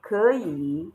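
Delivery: 可以 with 可 rising and 以 dipping; combined tones. Shown as a demonstration. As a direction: model 可以 as second tone then third. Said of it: "see how I combined the tones".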